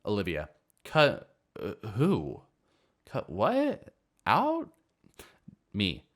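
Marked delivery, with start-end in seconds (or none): 0.9-4.7 put-on rising, bewildered drunken questions; drunk confusion. Drunk girl puzzled by the owner's order. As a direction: sound lost and tipsy.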